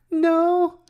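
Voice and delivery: very high, sheepish mock "no"; tiny laugh, then a squeaky denial; mimicked unconvincing denial. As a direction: play an obvious fib in falsetto. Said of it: "And she's like".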